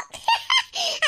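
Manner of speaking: high gloating giggle that falls away; gleeful laugh. Acted. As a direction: savour the quarrel with a laugh. Gloating alone after the fight.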